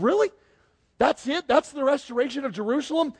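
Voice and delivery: high-pitched, let-down disbelief; incredulous questioning. Acted. Asking about the restoration of Jerusalem, incredulous. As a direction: question it as if badly underwhelmed. Voicing disbelief at an underwhelming ending.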